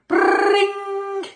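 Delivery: a high, loud ringing bell sound; alarm bell imitation. A narrator's reading. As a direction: ring it out like a fire alarm. Fire alarm bell rings.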